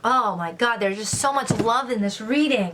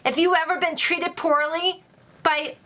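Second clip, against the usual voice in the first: excited; 6 semitones higher than usual. Real, not acted. Reader announces real deal.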